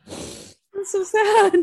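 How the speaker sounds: mock crying. Mock sobbing at the dead gerbil.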